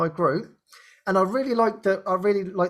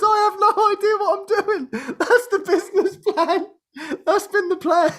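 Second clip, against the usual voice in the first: laughing confession; 11 semitones higher than usual. Retold or imitated. Admits no business plan, laughing.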